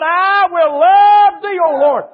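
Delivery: fervent praise. Acted. Preacher voices David's praise.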